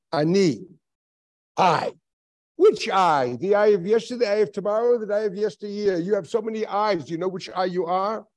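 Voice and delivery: high, loud, pressing questions; insistent probing. Retold or imitated. The rabbi grills his pupil about which I, loudly. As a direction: drive each question home.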